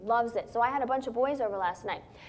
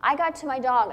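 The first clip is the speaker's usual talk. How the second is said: normal talking voice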